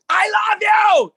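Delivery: high, drawn-out yell of affection; demonstrative full-volume shout; really loud. Shown as a demonstration. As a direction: scream it right in their face. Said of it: "If you say it really loud to their faces".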